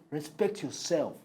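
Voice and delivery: soft, slow warning; restrained warning. Acted. Co-host warns the offended host, restrained and slow. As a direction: warn quietly, word by word. First warning in name-calling quarrel.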